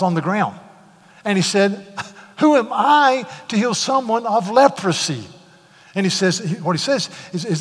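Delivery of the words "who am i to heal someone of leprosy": distressed cry. Retold or imitated. King tears clothes, despairs.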